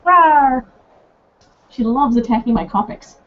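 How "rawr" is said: loud mock monster growl; playful rawr.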